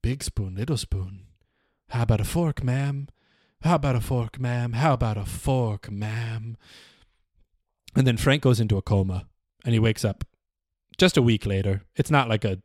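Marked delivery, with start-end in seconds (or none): 0.0-6.6 soft, fading inner voice while being beaten; quiet repeated thought, trailing off to almost nothing. Acted. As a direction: murmur it to himself, dropping away. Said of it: "he just is thinking".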